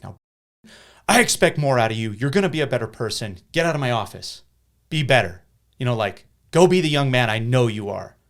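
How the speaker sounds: loud, high, scolding lecture; stern telling-off. Retold or imitated. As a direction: dress the kid down like a furious principal. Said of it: "kinda attitude".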